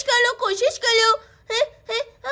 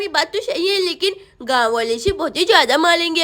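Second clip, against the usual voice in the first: ordinary talk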